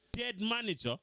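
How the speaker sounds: ordinary delivery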